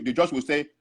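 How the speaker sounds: normal talking voice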